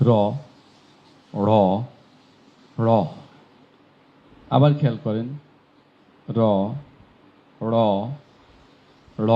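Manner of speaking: র, ড় and ঢ় said in turn, each crisply; three sounds contrasted. Shown as a demonstration. Teacher drilling three letters for comparison. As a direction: alternate the three letters clearly. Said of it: "আমি তিনটি অক্ষর এখন বলি, খেয়াল করেন".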